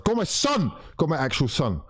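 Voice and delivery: sudden high-pitched cheer; excited cheer. Real, not acted. Fan cheering Smith Rowe's run down the right, excited high cry. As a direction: burst out high, cheering him on. Cheering a player mid-attack.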